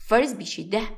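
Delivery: usual speaking voice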